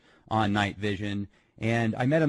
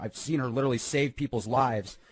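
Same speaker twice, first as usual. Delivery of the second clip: ordinary talk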